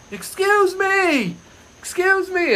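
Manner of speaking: high, loud call to someone far below; yelling. Retold or imitated. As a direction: re-enact hailing a stranger from a balcony. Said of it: "I yell at her".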